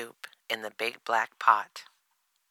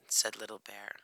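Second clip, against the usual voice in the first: usual speaking voice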